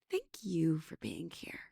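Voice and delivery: cheeky whispered thanks. Retold or imitated. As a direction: whisper the same words back to him. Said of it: "And I looked up at him and I said".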